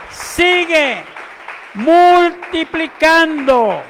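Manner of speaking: high, shouted pulpit proclamation; drawn-out, very loud preacher's cry; full-force preaching. Real, not acted. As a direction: shout it slowly to the congregation, phrase by phrase. Shouts praise to congregation.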